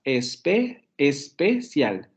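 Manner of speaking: especial split slowly into es, pe, cial; three syllables. Shown as a demonstration. Teacher counting Spanish syllables aloud, three-syllable especial. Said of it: "en español tiene tres sílabas".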